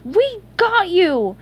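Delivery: high, loud, stretched call. A narrator's reading. SpongeBob to his trapped pet, calling out. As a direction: holler reassurance slowly and high. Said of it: "SpongeBob called".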